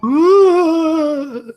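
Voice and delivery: high ghostly shriek; ghost wail. Acted. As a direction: let out a high fleeing ghost cry. GM voices fleeing ghost.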